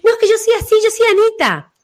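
high, insistent imitation of someone clinging to a nickname; mock stubborn high voice; mock self-assured voice. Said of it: "La gente dice".

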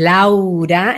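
"Laura" drawn out in full; broad, open "Laura"; whole, strong name. Shown as a demonstration. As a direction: give LAURA its full length and strength. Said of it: "entero daría esta fuerza".

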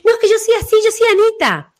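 high, insistent mock protest. Client protesting she is just Anita, high insistent mock. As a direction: mimic someone clinging to their nickname. People insisting on their name.

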